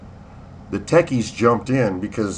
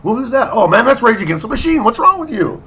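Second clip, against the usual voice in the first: mocking young kid; 11 semitones higher, 6 dB louder than usual. Quoted back-and-forth about bands.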